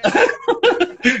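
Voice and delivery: sudden high-pitched laugh; high burst of laughter. Real, not acted.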